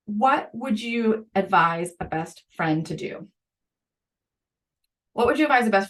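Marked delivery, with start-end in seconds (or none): 0.1-3.3 each word spaced out slowly; slow it right down to land the key question; slow and deliberate. Shown as a demonstration. Podcast host stressing the key question to teachers, slowly. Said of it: "and the third one, and this one is so essential, is".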